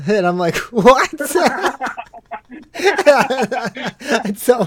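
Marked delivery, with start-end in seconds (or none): 0.7-1.1 high, loud, baffled what; incredulous what. Retold or imitated. Cadet reacting to the colorblind excuse, loud baffled what. 1.1-4.6 high laugh breaking into the words. Real, not acted. Telling the colorblind story to his co-host, laughing high.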